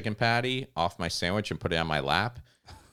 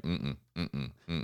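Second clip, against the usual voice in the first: disapproving judge; 6 semitones lower, 11 dB quieter, slower than usual. Retold or imitated. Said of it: "judges that's always like".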